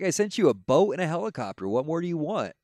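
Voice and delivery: high, loud exasperated answer; hammed-up exasperated God. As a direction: play God fed up with him.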